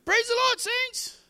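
very high, put-on mocking call; imitated passer-by's greeting. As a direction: mimic a passer-by in a squeaky high voice. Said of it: "we had a guy used to come".

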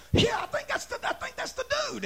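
high, excited lift; excited little guy. Acted. Leper recognizing Jesus from afar, high excited voice. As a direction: pipe it up high, eagerly. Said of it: "And he goes".

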